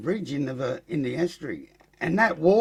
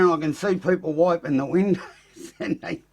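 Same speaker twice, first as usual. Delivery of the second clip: ordinary delivery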